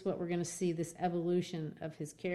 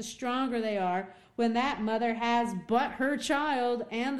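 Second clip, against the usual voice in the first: impassioned plea; 4 semitones higher, 3 dB louder than usual. Acted. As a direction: beg him to speak for her. Hester pleads with Dimmesdale.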